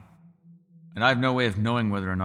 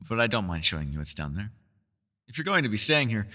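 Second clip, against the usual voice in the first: ordinary talk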